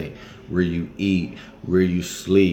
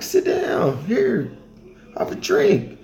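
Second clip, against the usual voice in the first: high voice offering a drink; hospitable local; 11 semitones higher, slower than usual. Retold or imitated. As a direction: insist kindly he sits and drinks.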